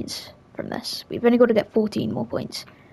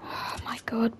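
whispering; 9 dB quieter, slower than usual. Real, not acted. Tense moment mid-fight.

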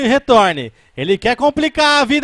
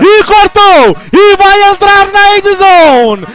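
high, loud rising run call; excited play-by-play; 8 semitones higher, 5 dB louder than usual. Real, not acted. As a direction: build it toward the score. Run toward end zone.

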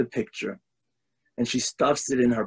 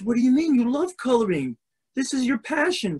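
higher, coaxing mom's voice; puzzled mother; 9 semitones higher than usual. Acted. Mother reminds daughter she loves coloring, high coaxing. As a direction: remind her gently but firmly.

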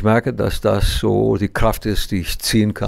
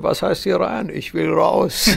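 put-on drunk punchline; 7 semitones higher, slower than usual.

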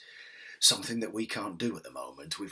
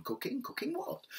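much higher, rising astonished question; amazed disbelief; 3 dB quieter than usual. Acted. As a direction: gasp out the question in wonder. Second villager amazed.

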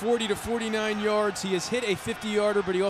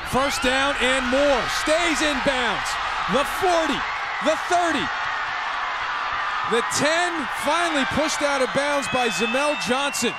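excited play call; 4 semitones higher than usual. Real, not acted. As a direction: build the call with each yard line. Big run, play-by-play call.

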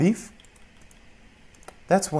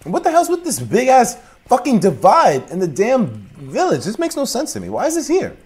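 incredulous outburst; 12 semitones higher, 5 dB louder than usual. Real, not acted. Gamer yelling at a baffling village, outraged. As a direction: shout at the ridiculous village.

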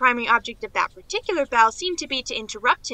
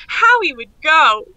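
drawn-out, louder delighted exclamation; gleeful; 4 semitones higher, 6 dB louder, slower than usual. Acted. Niece gleefully imagines Mister Morrison racing for a chair, drawn out. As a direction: relish the picture of him rushing. Girl delights in picturing the race.

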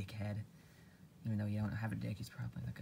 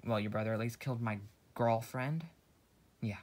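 ordinary delivery

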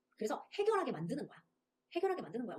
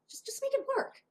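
encouraging; 13 semitones higher, much slower than usual. Shown as a demonstration. Pep-talk line, pitched high.

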